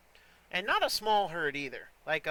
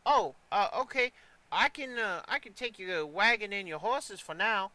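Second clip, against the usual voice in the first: put-on higher-pitched eager young groom; teenage groom, flustered; 3 semitones higher than usual. Young groom greeting arriving customers, flustered. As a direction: stammer a welcome as a teenage stable hand.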